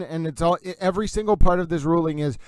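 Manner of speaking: ordinary delivery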